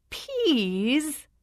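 high, rising gasp of disbelief; shocked gasp. A narrator's reading. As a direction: recoil at the sight of "Peas?". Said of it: "the princess gasped".

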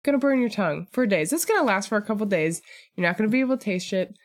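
rattled off quickly; fast. Real, not acted. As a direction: rush through the joke. Rapid joking riff.

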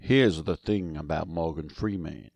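very low, slow celebrity impression; badly attempted deep, measured star voice; Morgan Freeman. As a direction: drop deep and take your time, like a famous narrator. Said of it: "like, Morgan Freeman or something".

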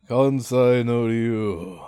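very slow, weighty reveal of the name; dramatic title. Retold or imitated. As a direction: draw out the name like a legend.

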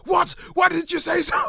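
high, loud shocked shout; hammed-up priest suddenly eager for the fight; mock outraged shout. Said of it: "And he's like".

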